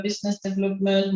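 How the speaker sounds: ordinary delivery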